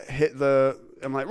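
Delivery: ordinary delivery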